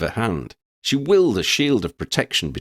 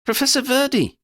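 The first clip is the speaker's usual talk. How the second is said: put-on young woman's voice, much higher; Lydia's voice. A narrator's reading. Lydia calls out to the professor, high pitched. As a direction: Lydia calling her professor, high and urgent. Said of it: "she said".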